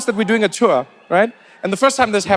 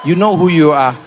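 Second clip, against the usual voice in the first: loud pointed callout; called out; 3 semitones lower, 4 dB louder than usual. Real, not acted. Trevor calling out South Africans in the crowd, loud. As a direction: point at the crowd and call them out.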